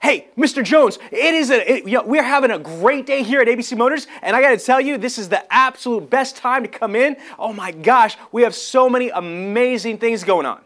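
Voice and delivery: bright, high, loud salesman pitch with laughs; thrilled car salesman hyping the sale. Shown as a demonstration. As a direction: pump it up high and excited. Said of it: "inflect it higher".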